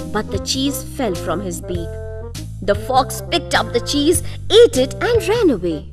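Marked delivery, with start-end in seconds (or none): none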